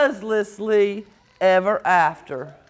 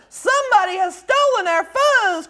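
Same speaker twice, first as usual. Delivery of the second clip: crying out; 9 semitones higher than usual. Acted. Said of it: "cried Pipsqueak".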